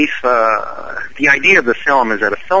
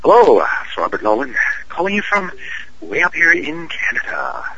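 playful Canadian accent, pitched higher on the repeat; hammed-up Canadian voice; 6 semitones higher than usual. Shown as a demonstration. Actor redoes his Canadian voice for the podcast host, higher and hammed up. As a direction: lay the Canadian accent on thicker for the encore. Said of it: "do it one more time".

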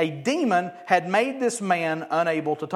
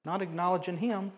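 ordinary talk

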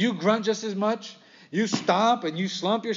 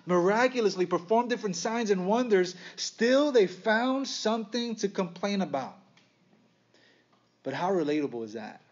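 ordinary talk

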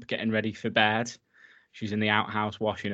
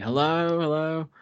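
calling out; 7 semitones higher than usual. Retold or imitated. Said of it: "she's saying".

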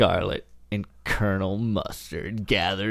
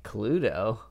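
ordinary talk

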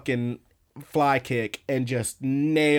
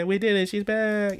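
goofy high-pitched cheer; Hijiri; 8 semitones higher than usual. Retold or imitated. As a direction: celebrate in a silly high cartoon voice. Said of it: "how goofy she says it".

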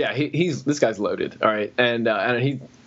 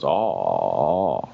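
low mock grunt; 13 semitones lower than usual. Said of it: "he goes".

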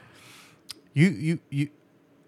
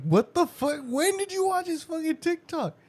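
shocked disbelief. Retold or imitated. Dad's shocked question to son.

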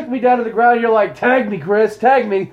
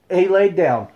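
ordinary talk, nothing marked

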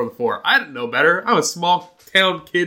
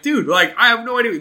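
higher, louder mock pleading; clueless kid defending himself; helpless protest; 10 semitones higher, 6 dB louder than usual. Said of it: "And I'm like".

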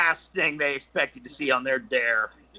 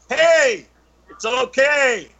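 higher, louder call down a tunnel; 8 semitones higher, 5 dB louder, slower than usual. Retold or imitated. Storyteller calling to scared kids in tunnel, raised voice. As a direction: call out to reassure, breaking into laughter.

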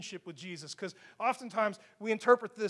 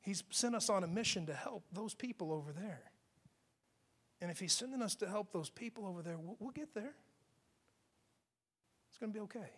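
compassionate; 2 semitones lower, 13 dB quieter than usual. Acted. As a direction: comfort them quietly, unhurried. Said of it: "It has compassion".

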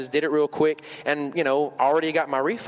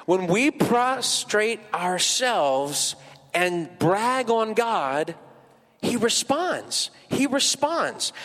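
slow, emphatic preacher cadence; preaching; 6 semitones higher, much slower than usual. Acted. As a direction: drag out each word to drive it home.

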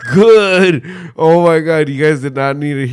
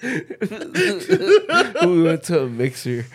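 laughing; 13 semitones higher, slower than usual. Real, not acted. Laughing sarcasm.

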